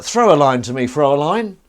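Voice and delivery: put-on German accent. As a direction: play a hammy German charmer. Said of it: "I was like".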